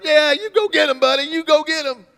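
high, loud cheering from the car; egging him on, hammed up; mock cheer. Driving past the street preacher, loud excited cheer.

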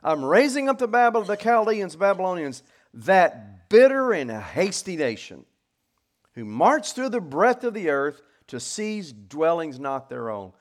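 loud, high, slow declamation easing off at the end; ringing pulpit reading; proclaimed scripture. Acted. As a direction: announce God's answer with weight. Reads God's answer aloud.

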